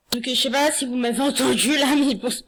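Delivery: embarrassed laugh through words. Real, not acted. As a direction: laugh it off sheepishly. Embarrassed after sneeze.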